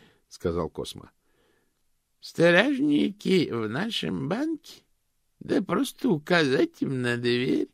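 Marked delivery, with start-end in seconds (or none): 2.2-7.7 put-on high, slow, cracked old lady. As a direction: croak like a tiny ancient aunt. Old aunt's disdain.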